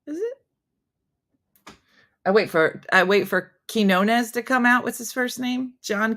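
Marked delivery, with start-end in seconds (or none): 0.1-0.4 much higher, softer doubtful question; mock doubt, a raised-eyebrow "is it?"; skeptical. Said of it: "I'm like".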